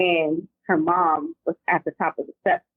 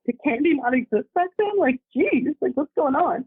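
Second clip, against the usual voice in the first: mock disbelief; 8 semitones higher than usual. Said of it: "I'm like".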